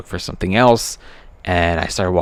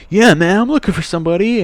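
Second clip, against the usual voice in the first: higher, animated dude voice; acted upbeat store guy; easygoing store manager; 11 semitones higher than usual. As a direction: voice a casual, keen manager in a higher pitch.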